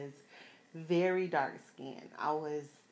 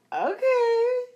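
much higher, louder squeal; giddy mock squeal; excited okay; 8 dB louder than usual. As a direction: gush at the compliment. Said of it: "I was like".

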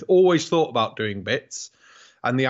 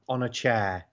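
sarcastic deadpan; slower than usual. Retold or imitated. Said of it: "get the questions asked in the pub".

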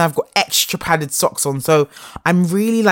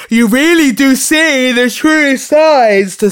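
high, slow, loud emphasis; emphatic; 10 semitones higher, 8 dB louder, slower than usual. Real, not acted. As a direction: drive the point home slowly. Emphatic point about living together.

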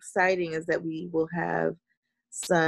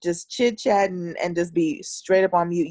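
ordinary delivery

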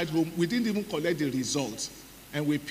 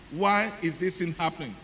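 ordinary talk, nothing marked

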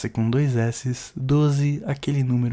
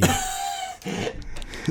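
weird laugh; 9 dB quieter than usual. Real, not acted. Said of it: "Que que foi isso, mano?".

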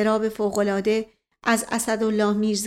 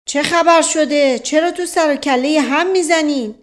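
raised, higher voice breaking up a fight; booming challenge to two fighters; loud demand; 6 semitones higher, 7 dB louder than usual. A narrator's reading. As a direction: confront the brawlers loudly.

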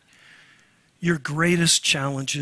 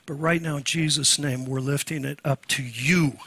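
prayer; 2 semitones lower than usual. Shown as a demonstration. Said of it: "I'm just gonna say".

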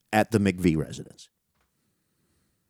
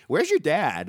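higher-pitched mock shout from another room; imitating a call; 8 semitones higher than usual. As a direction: imitate someone calling out, pitched up. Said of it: "and I hear".

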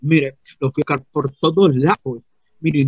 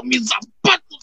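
mock alarm; 10 semitones higher than usual. Comic alarm at lost shoes.

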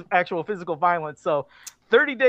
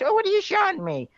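grandmother; 11 semitones higher than usual. Retold or imitated. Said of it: "these grandmothers".

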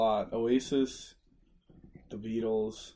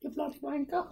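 high-pitched put-on British accent; exaggerated British insult voice, high; mock British accent. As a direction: squeak it out in a cartoonish British accent. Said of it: "I can't even do a fucking accent".

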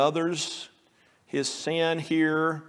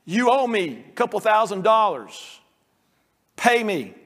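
loud, high demand for the money; angry demand; 7 semitones higher, 5 dB louder than usual. Acted. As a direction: bark out the debt like a bully. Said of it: "he's choking the guy".

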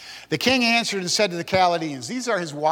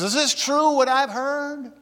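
much higher, acted angry questioning; angry king; 10 semitones higher than usual. Furious king confronting the men brought in, acted anger. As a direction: demand it as an enraged king, pitched high. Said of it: "no doubt he's yelling at them".

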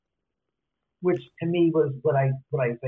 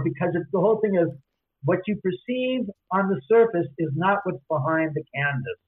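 ordinary talk, nothing marked

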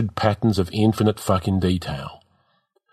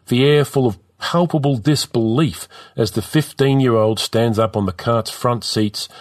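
ordinary delivery, nothing marked

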